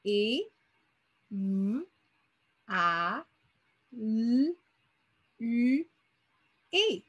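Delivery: "et, v, a, l, u, et" each sound said alone with pauses between; sounds one by one. Shown as a demonstration. Teacher sounding out évaluer for pupils, one sound at a time. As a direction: sound out each phoneme separately. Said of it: "moi, j'entends".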